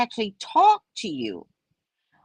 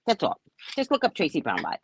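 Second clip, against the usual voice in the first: ordinary delivery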